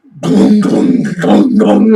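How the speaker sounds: loud imitated booms; loud mock booms; booming noise imitation. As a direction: mimic the dryer banging loudly. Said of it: "I hear this".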